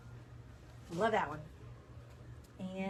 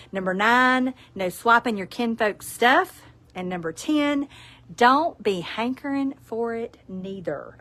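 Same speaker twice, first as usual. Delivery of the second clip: Southern reading; 2 semitones higher, 5 dB louder, slower than usual. Acted. Reads Southern Ten Commandments.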